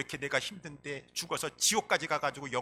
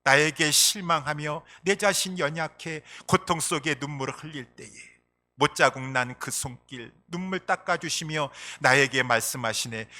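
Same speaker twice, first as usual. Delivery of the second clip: normal talking voice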